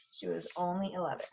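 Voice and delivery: soft whispered repeat. Real, not acted. Reading to her class, a quick hushed whisper. Reader repeats line for emphasis.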